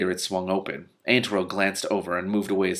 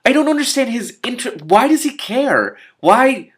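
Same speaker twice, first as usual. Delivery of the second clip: much higher, louder bewildered why; exasperated outcry; 9 dB louder than usual. Real, not acted. Reader baffled by the villain's motives, high and loud. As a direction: plead to understand him. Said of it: "reader exasperated at Vamis".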